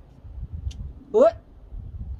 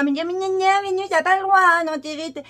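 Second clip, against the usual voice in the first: high, loud whiny mockery; whiny killjoy; 5 semitones higher, 4 dB louder than usual. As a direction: mimic the nagging killjoys. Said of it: "les rabat-joie".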